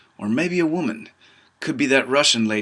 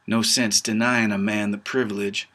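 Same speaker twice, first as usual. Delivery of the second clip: usual speaking voice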